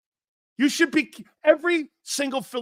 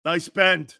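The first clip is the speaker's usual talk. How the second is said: ordinary delivery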